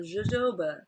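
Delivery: jojoba as "zho-ZHO-bah"; J sound. Shown as a demonstration. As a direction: insist on jojoba with soft zh sounds. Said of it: "It's a J".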